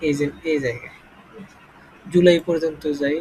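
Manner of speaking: ordinary delivery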